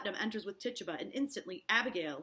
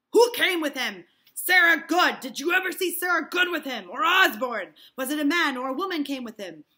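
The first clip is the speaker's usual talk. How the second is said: loud, insistent questions; pressing interrogation; 7 semitones higher, 14 dB louder than usual. Acted. Hale pressing Tituba hard for names, loud. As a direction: demand the names.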